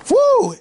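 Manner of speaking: high-pitched "woo" of excitement; excited whoop. Real, not acted. Movie fan raving to viewers, excited whoop.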